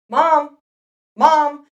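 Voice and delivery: put-on loud, high child calling Mom, Mom; kids calling mom. Child calls to a busy mother, loud and high. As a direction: holler for mom like an impatient kid.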